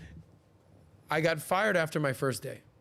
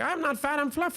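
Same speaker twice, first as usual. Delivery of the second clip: much higher comic voice for a stand-up catchphrase; much higher imitation of the comedian's famous line; Fluffy impression; 12 semitones higher than usual. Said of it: "Fluffy Gabriel Iglesias is like".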